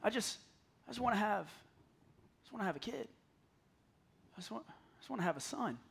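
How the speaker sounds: hushed, halting and heartbroken; very soft acted grief, breaking off and restarting; anguished plea. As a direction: pour out a quiet plea to God, barely getting it out. Hannah pleads in anguish before God.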